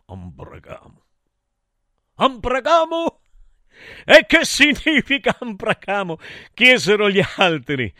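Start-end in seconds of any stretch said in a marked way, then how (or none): 0.1-1.0 very soft, low murmur; hushed, low-pitched aside. A narrator's reading. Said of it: "disse a voce bassa". 2.1-6.2 high, raised questioning voice; high-pitched incredulous echo; puzzled question. Acted. Other gods ask aloud, puzzled.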